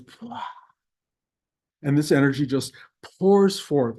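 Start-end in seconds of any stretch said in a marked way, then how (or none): 0.0-0.4 burst sound. Retold or imitated. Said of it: "my heart goes".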